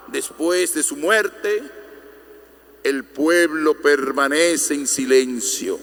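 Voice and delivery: normal talking voice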